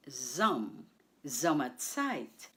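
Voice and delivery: "Sommerzeit" with weight on "Som", after "Som" alone; stress on SOM. Shown as a demonstration. Teacher shows learners where stress falls, first syllable stressed. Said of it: "The stress is on the first syllable".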